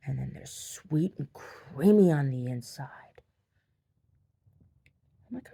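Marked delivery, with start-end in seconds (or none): 0.1-3.2 slow, drawn-out savoring; relishing grandma. Retold or imitated. Grandma describing eggs to her grandchild, slow relish. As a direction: linger lusciously on each treat word.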